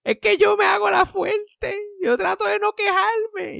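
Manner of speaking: very high, slow, put-on old-lady voice; mock frail grandma. Grandma claiming she never complains, very high and slow. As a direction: stretch it out like a martyred granny.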